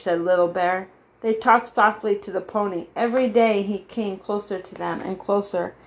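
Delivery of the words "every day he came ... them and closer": usual speaking voice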